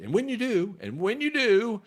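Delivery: mock a speaker who loses his thread; Joe Biden impression. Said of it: "sounds like something Joe Biden would say".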